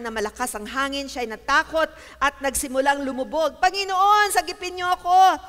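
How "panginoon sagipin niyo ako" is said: high, loud plea; crying out. A narrator's reading. Sinking Peter to the Lord, crying out. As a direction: call out high and loud for rescue. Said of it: "sigaw niya".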